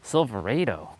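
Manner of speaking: Silverado said "silver-AY-do"; unfamiliar pronunciation. Retold or imitated. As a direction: say Silverado with a long AY, falling at the end. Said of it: "Never heard it sa-, said like that before".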